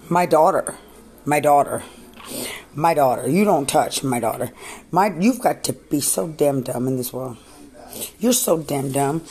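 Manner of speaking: loud, raw protective yelling; real furious shouting. Real, not acted. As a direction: bellow it in defence of her daughter. Angry rant at officials.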